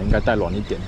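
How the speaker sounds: normal talking voice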